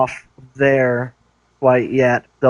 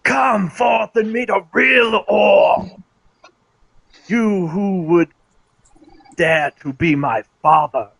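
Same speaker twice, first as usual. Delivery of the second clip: shouted challenge; 5 semitones higher than usual. Acted. Player's character challenges the orcs.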